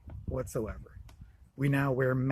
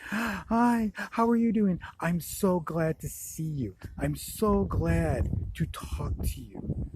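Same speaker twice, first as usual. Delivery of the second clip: excited ladies' greeting; 4 semitones higher, much slower than usual. Retold or imitated. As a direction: gush with joy like the old ladies.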